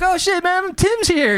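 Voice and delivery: light up at spotting a friend; excited shout. Retold or imitated. Excited to see Tim at festival.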